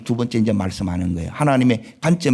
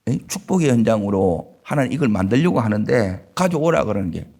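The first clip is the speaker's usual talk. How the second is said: ordinary delivery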